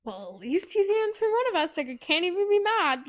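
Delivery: high-pitched mock huffiness; acted huffy relief. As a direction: voice it up high, as if begrudgingly letting it go. Character voiced reacting to answered call.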